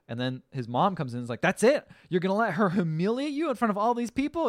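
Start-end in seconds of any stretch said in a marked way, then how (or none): 1.4-4.5 outraged mother. Retold or imitated. Mother-in-law outraged at son.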